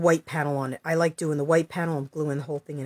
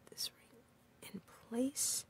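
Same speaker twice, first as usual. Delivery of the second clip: breath held, barely voiced; holding breath; 6 semitones higher, 14 dB quieter, much slower than usual. Real, not acted. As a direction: squeeze it out while holding your breath. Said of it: "I'm kinda holding my breath".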